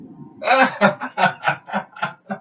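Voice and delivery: loud high-pitched giggle in the patient voice; patient's high laugh. Acted. Patient laughs at own joke.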